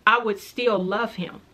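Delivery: ordinary talk, nothing marked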